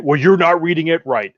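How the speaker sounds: high, loud acted rebuttal; heated retort. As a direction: fire back as in a movie argument. Acts out film argument over tracker.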